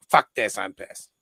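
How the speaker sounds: low mock-angry grumble; imitating angry friend. Friend storming off after losing his wallet, low mock grumble.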